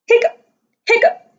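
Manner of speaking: squeaky high hiccup sound; a sudden high-pitched hiccup. Acted.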